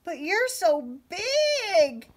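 very high, slow, loud mouse voice; tiny mouse. A narrator's reading. Little mice telling Little Red she is huge, awed. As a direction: squeak it out in awe.

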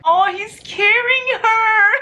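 very high, loud, drawn-out swooning squeal; excited squeal. Real, not acted. Reader swooning as he carries Juliet, excited squeal. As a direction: squeal it slowly with delight.

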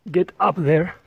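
normal talking voice

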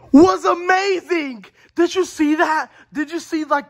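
excited. Acted. Hyped fan reacting to trailer.

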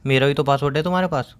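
usual speaking voice

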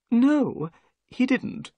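high-pitched little man's reply; nervous little man. A narrator's reading. Little man answers the detective's question, nervous. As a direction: answer high, in the odd host's voice. Figure of Thaddeus Sholto.

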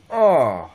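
sudden loud pained yelp; sore muscles. Real, not acted. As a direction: cry out at a stab of pain. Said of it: "Shooting pain go from my foot".

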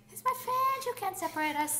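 mock clingy squeal. Two friends retelling high school clinginess.

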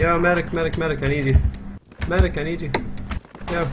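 calling for a medic. Real, not acted.

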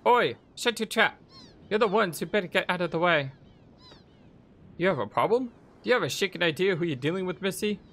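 high, cocky fighter's challenge; feisty girl. Acted. Girl telling captors to get out of the way, cocky high voice.

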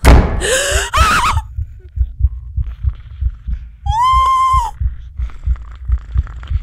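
big gasp into a high shriek; loud, piercing scream of shock; terrified scream. Real, not acted. Player hit by a scare, terrified scream.

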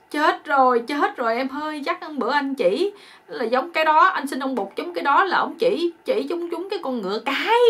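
high, loud mock panic; hammed-up horrified realisation; acted dismay. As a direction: wail as the husband who blundered. Husband realises his terrible mistake.

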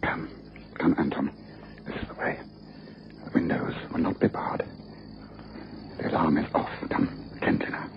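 hushed whisper while creeping through the house. Acted. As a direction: whisper so nobody in the house wakes. Sneaking into the house at night.

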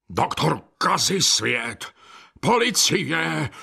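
slow, drawn-out cry; restrained bellow with long vowels. A narrator's reading. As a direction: call out angrily, stretching each word. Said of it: "řičel řemenář".

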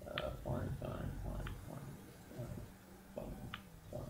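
whispered fine, fine to himself; barely voiced grumble; muttered under breath. Real, not acted. Checking his emails mid-stream, muttering quietly.

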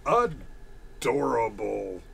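slow, drawn-out reading; mock adoring. Streamer reading a viewer's jab, mock adoring. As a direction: stretch it out mockingly. Mocking read of a chat comment.

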